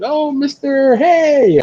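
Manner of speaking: high, loud excited hello; clerk's excited greeting. Retold or imitated. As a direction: greet him like an eager fan. Store clerk greets him.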